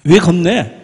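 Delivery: a hammed-up telling-off; scolding. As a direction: pretend to tell him off. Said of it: "라고 야단치지 않았어요".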